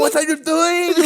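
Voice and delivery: very high, strained mock wail; hammed-up pleading movie boxer; Rocky Balboa. Mocking co-host's Italian Rocky, high exaggerated cry. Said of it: "that's a good impersonation of Rocky Balboa".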